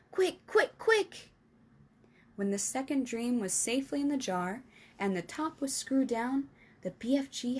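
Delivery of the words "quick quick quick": much higher, louder hurrying; urgent. Acted. Giant urging Sophie, high and frantic. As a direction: rush her.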